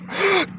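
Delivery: high startled gasp; sharp gasp. Acted. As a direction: gasp in surprise. Sees the robot.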